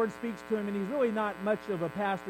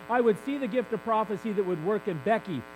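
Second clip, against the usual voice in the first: usual speaking voice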